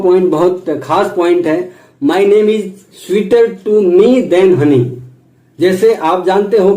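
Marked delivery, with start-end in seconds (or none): 2.0-4.8 slow quote. Shown as a demonstration. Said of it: "quote read slowly".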